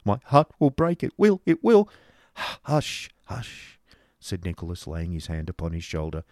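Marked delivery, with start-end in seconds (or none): 0.0-1.9 loud, high, heartbroken wail; despairing outburst from a crying boy; tearful cry. A narrator's reading. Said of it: "he cried". 2.4-3.8 whispered, calming shush; soothing hush. A narrator's reading. Nicholas soothes the crying boy.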